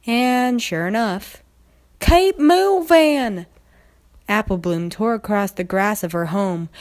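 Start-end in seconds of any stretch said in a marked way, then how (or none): 2.0-3.5 high, loud, drawn-out cry to keep running; panicked shout. Acted. Filly shouting to friends while fleeing in terror. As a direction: yell at your friends to run.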